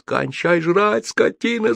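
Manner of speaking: high, drawn-out scolding holler; chorus yell. A narrator's reading. Crew yelling at him to stop eating, high and drawn out. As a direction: stretch the yell as if calling afar. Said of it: "заорали мы ему хором".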